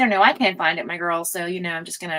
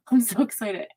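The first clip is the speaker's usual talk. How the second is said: ordinary delivery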